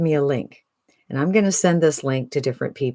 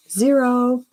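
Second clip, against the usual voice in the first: excited high zero; 10 semitones higher, 3 dB louder than usual. Acted. Excited about no tech.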